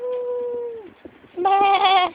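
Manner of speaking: high, loud mock sheep bleat. Sheep caught by the jellyfish, a loud high mock bleat. Sheep voiced being stung.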